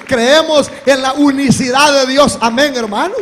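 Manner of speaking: loud, driving pulpit preaching; fiery preaching. Acted. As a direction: hammer the doctrine home to the church. Preaching at full force. (predicación enérgica a todo pulmón)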